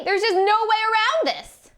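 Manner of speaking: high, slow gloating; smug certainty. Acted. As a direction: savor your invincibility. Macbeth smug.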